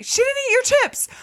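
high, fast squeaky imitation of Ashley; squeaky mock imitation. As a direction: repeat her line in a high, quick, cartoonish voice. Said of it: "I don't know how they made it so funny".

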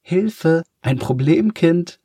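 high, loud teasing call for help; giggly mock call. A narrator's reading. Girl mocks the new boy to the class, calling out in mock alarm. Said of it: "ruft sie kichernd in den Klassenraum".

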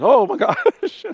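high, loud, slow laughing "oh my gosh"; laughing disbelief. Retold or imitated. Anna seeing the powdered woman, laughing in disbelief. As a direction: burst out laughing in shock.

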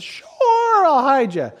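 high, drawn-out "sure"; hammed-up, too-willing reassurance; falsely obliging woman. Preacher playing the tent woman luring a fugitive, falsely obliging.

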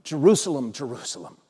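hushed, sinking lament; heartbroken. Acted. Jesus calling to the people of Jerusalem, quietly heartbroken. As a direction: let the second call fall away softly. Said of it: "When his heart was breaking".

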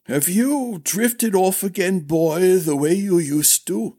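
high, querulous aged voice; scolding old master-at-arms. Acted. Old master chiding his silent pupil, high and querulous.

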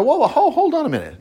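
high-pitched interrupting protest; mock alarm. Preacher interrupting his own Bible reading, mock alarm. Acts out interrupting the law he reads.

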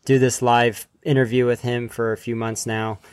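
ordinary delivery

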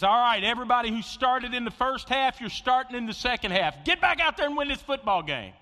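rally the team like a coach; coach's halftime speech. Retold or imitated. Pastor voicing the coach firing up the team, loud.

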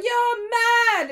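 high shocked cry; shocked shout. Retold or imitated. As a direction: gasp it out in shock. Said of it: "everybody's like".

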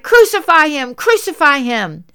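reads the crowd's cry loud and high, easing on the repeat; crowd shouting, retold. Reading the crowd's cry to Pilate, a retold shout. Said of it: "They shouted".

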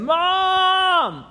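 very high, loud, long holler for mother; full-blast child's scream for mom; drawn-out yell. Acted. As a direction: bellow and stretch it out like an insistent kid. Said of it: "he, she hears his voice call out again".

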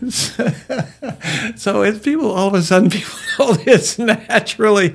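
high-pitched, amused talk through a laugh. Real, not acted. Frog joke teller getting back to his point, laughing. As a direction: still cracking up while making the point.

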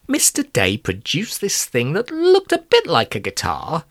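ordinary delivery

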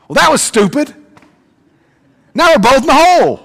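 high, loud exasperated scolding. Acted. Stuck man scolds his buddy for jumping in, exasperated. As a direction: scold the friend in disbelief.

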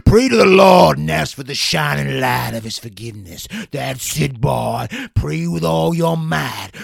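loud, ecstatic revival preacher; fervent exorcist preacher. Acted. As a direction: roar at the devil, full fervour. Exorcist ritual held throughout.

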